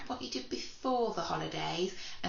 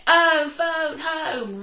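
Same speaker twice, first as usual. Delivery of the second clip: loud, slow, higher shout; 9 semitones higher, 12 dB louder, much slower than usual. Shown as a demonstration. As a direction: shout the rhyme out to the class. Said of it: "I want you to shout it".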